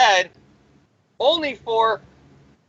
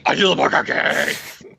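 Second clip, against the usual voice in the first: mock menacing voice; 3 semitones lower than usual. Villain boasting of the mark of Cain, hammed-up growl. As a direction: growl it like an over-the-top comic-book villain. Same boast redone in put-on voice.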